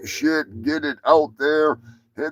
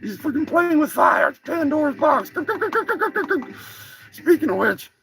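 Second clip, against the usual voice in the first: brash turkey voice, pitched higher; a cocky cartoon turkey boasting; turkey character; 10 semitones higher than usual. Brash turkey puppet talks back to the host, higher voice.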